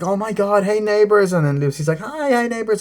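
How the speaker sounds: high, gushing mock neighbor voices; hammed-up suburban small talk; fake friendly neighbors.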